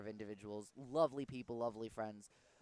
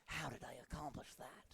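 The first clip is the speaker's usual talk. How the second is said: Snake impression; 4 dB quieter than usual. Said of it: "I do a Snake impression".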